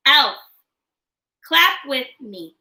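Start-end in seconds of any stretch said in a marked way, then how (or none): 0.1-0.4 one-beat syllable. Shown as a demonstration. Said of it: "Break words in two parts with me".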